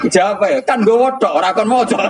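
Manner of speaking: very high, laughing through words; laughing punchline. Real, not acted. (menyampaikan lelucon sambil tertawa terpingkal-pingkal)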